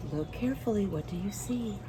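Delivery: usual speaking voice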